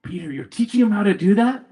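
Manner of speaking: high-pitched incredulous question; mock disbelief. Pub crowd turning on the brother, mock-shocked. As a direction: pitch it way up as if appalled.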